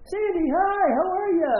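high, loud gushing hello; delighted greeting. Retold or imitated. Art greets his old friend Sandy, acted delighted hello. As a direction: play an old friend thrilled to see her.

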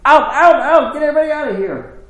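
high, loud, rapid shouting; screaming. Retold or imitated. Woman upstairs clearing out the construction crew, screaming. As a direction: shout them all out of the house. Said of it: "she'd come down and yelling and screaming".

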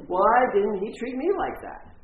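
high, loud acted complaint; hurt protest. Intern downstairs hears the friendly party, indignant hurt protest. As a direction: play her wounded and indignant. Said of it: "her feelings are hurt".

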